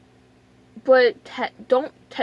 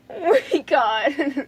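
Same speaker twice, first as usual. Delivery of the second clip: very slow, whimpering wail; tearful dread; much slower than usual. Retold or imitated. Recalling the needle tray to viewers, tearful dread. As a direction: draw it out as if near tears. Retold dread on seeing the equipment.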